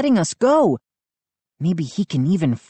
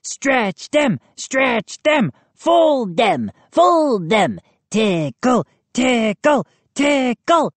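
high rhythmic chant; shrill gnome voices; 7 semitones higher, slower than usual. A narrator's reading. Gnomes chanting over trapped children, shrill chant. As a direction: chant it like a gleeful little mob. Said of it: "over the shrill gnome voices".

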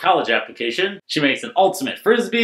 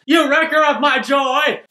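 mock shout; 9 semitones higher than usual. Narrator echoing Wendy's line, mock yell. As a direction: yell it like a playful accusation.

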